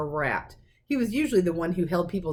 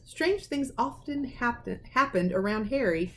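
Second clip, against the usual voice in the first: ordinary delivery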